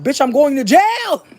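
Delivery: high, drawn-out cry of doom; mock panic. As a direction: cry it out slowly in comic panic. Retold panic about going to jail.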